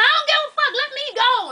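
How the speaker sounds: mock furious yell; defiant outburst. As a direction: shout to break free. Mona fights to leave.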